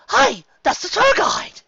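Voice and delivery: high, loud surprised cry; excited shout. A narrator's reading. Carrot Top spotting the tour guide, excited and high. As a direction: blurt it out in recognition.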